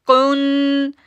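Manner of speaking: slow recitation; recited. Shown as a demonstration. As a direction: recite कुन in tajweed style. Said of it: "आगे हम पढ़ेंगे कुन".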